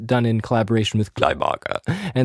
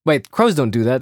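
ordinary delivery

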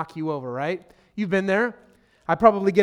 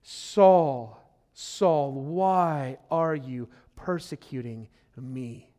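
voice of Jesus; 5 semitones lower, much slower than usual. Acted. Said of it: "Listen to this voice".

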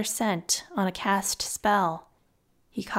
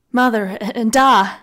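anguished; 2 semitones higher, 9 dB louder than usual. A narrator's reading. Boy fears for his parents.

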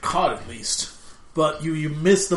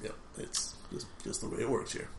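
muttering; 2 semitones lower, 14 dB quieter than usual. Real, not acted. Muttered reply.

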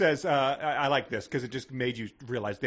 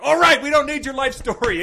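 loud, high mock exasperation; hammed-up fed-up outburst; exasperated interruption; 12 dB louder than usual. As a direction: cut them off at full volume.

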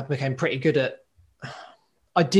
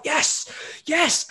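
retold excited yes, pitched way up; excited cheer; 13 semitones higher than usual. OT retelling a reaction to reading about occupational justice, excited. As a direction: re-enact a high-pitched burst of excitement. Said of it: "just gets me so excited".

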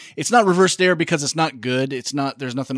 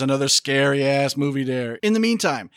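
ordinary delivery

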